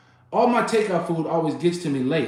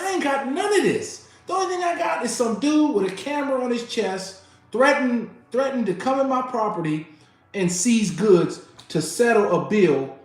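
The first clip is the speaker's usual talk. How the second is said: building retold yell, higher and louder; 7 semitones higher, 3 dB louder than usual. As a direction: list each step with rising outrage. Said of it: "this is where I'm yelling".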